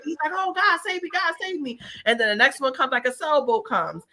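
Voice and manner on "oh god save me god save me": high, pleading cry to God; a drowning man pleading in a mock high voice. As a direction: beg God for rescue, voice up high.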